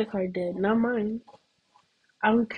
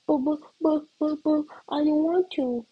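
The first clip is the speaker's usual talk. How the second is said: put-on much higher, stammering kid whine; whiny refusal; 7 semitones higher than usual. Little sibling refuses to leave the chair, high stammering whine. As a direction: sputter "but" over and over like a stubborn child.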